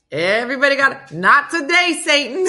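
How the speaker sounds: loud, high and fast, laughing; excited rebuke. Acted. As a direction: shout it down with glee.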